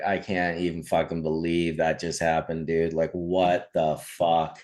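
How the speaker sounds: low-pitched retold disbelief, dragging at the end; stunned disbelief. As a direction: mildly play a stunned buddy, voice dropped low. Buddy stunned the whole ride home.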